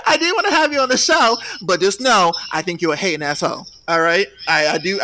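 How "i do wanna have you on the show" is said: high, loud, still cracking up; laughter spilling into the words; through laughter. Real, not acted. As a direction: get it out while recovering from a laugh. Resuming after laughing fit.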